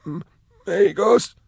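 a wrecked prisoner forcing out a forgotten word; broken stammer. Acted. Caged prisoner haltingly naming himself to strangers, high stammer. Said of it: "His mouth moved oddly as he spoke".

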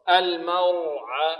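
very slow, drawn-out recited word; Quran recitation. Shown as a demonstration. Teacher models heavy ra for pupils, slow recitation. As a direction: hold the vowels evenly in a measured chant.

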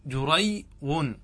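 slow demo. Shown as a demonstration. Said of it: "وأصله".

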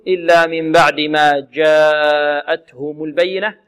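slow recitation, جاءتهم with a long held aa; four-count madd. Shown as a demonstration. Teacher modelling Qalun's reading, measured long vowel. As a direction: stretch the aa of جاءتهم for four beats. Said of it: "المد في هذه الكلمة أربع حركات".

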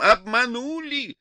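high, thin, drawn-out wail; thin, obnoxious cry. A narrator's reading. She cries deception after him, thin drawn-out wail. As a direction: wail it slowly in a nasty high voice. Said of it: "закричала она неожиданно тонким и противным голосом".

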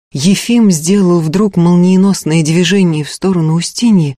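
usual speaking voice

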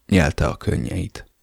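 ordinary delivery, nothing marked

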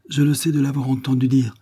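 balbutié dans un chuchotement plus haut. A narrator's reading. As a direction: se justifier tout bas, troublée. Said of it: "balbutia la malheureuse femme". (l'institutrice se défend devant Barbara, en balbutiant)

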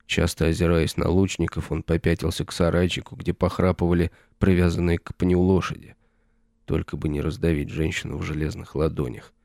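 usual speaking voice

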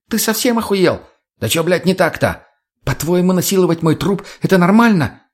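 high-pitched indignant demand; outraged. A narrator's reading. Tonya confronts the addict over her body, outraged. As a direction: challenge him in outrage.

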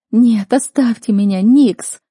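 high, loud frantic protest; ear-splitting cry. A narrator's reading. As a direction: scream to be let go. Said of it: "Мой голос резал слух".

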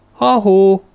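loud, high call towards the tent. A narrator's reading. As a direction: call out to someone who can't see you. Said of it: "kiáltotta a sátor felé".

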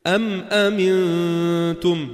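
أم أمنتم recited very slowly with the meem of أم held distinct; clear meem recitation. Shown as a demonstration. Teacher giving students another Quran example, slow recitation. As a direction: stop lightly on the meem of أم before أمنتم. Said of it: "كأنك تقف عند الميم".

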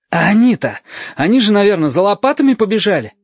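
put-on boy asking his friend in agitation; agitated question. A narrator's reading. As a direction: rise up in boyish disbelief. Stasik asks, agitated.

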